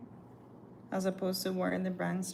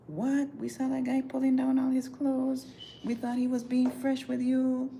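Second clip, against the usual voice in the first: high, louder gossiping coworkers; wide-eyed coworker gossip, pitched up and loud; mock shocked coworkers; 7 semitones higher, 5 dB louder than usual. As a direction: play the scandalised coworkers rushing up. Coworkers voiced in story.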